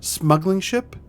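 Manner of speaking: incredulous. Acted. Incredulous echo of accusation.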